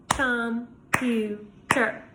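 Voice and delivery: "Computer" very slowly, three separate beats; syllable by syllable. Shown as a demonstration. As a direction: count out com, pu, ter with long gaps. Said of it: "How many times did my jaw move?".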